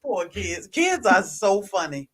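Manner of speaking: ordinary delivery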